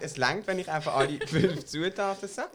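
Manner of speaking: laughing. Real, not acted. Laughs mid-sentence.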